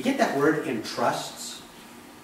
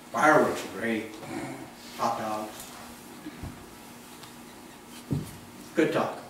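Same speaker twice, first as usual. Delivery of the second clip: lower, offhand small-talk voice; mock small talk; 6 semitones lower, 5 dB quieter than usual. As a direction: shrug it off with chitchat. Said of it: "you're like".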